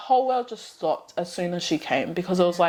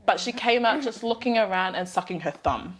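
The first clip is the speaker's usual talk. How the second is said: ordinary delivery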